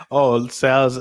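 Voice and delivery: ordinary talk, nothing marked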